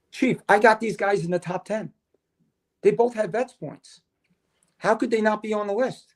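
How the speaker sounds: retold protest, pitched well up; indignant protest. Firefighter challenging the chief over names missing from the list, indignant.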